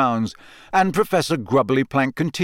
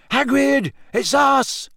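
very high, loud shout through a door; 14 semitones higher, 4 dB louder, slower than usual. A narrator's reading. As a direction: call out to a friend behind the door. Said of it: "Harry shouted".

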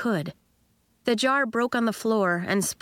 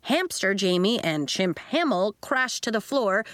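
usual speaking voice

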